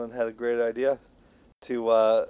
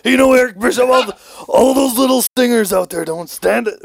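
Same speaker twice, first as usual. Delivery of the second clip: loud, high wrestler promo; shouted wrestling-villain rant impression; Hulk Hogan; 11 semitones higher, 10 dB louder than usual. Wrestler calling out his boss, loud high promo shout. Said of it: "I was going W C W Hogan there".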